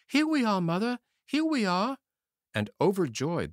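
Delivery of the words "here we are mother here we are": put-on high, loud children calling out; joyful cry. A narrator's reading. Children calling to their mother from outside the door, joyful. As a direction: shout happily through the door. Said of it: "cried out".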